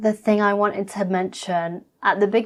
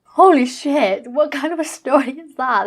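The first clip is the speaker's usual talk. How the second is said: shocked; 6 semitones higher, 4 dB louder than usual. Real, not acted. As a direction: blurt it out in disbelief. Reacting to gross clip.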